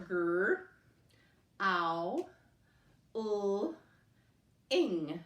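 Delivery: "Gr", "ow", "l", "ing" each said apart; sounding out parts. Shown as a demonstration. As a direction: break the word into its parts, slowly. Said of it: "Say the sounds as you do so".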